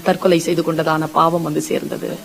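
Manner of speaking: usual speaking voice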